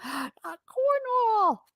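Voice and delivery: gasped, very high and drawn out; hammed-up, high-pitched dismay; mock horror. Streamer reacting to a chat message about Cornwall, mock horror.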